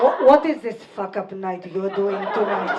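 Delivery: put-on low, loud heavy Moroccan accent, laughing. Comedian imitates her mother's Moroccan accent, laughing. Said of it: "in a heavy Moroccan accent".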